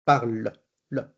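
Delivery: "parle" ending on the tongue's click of the L. Shown as a demonstration. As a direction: finish "parle" on a flicked L, then isolate that "le". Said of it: "el chasquido que da la lengua con la letra".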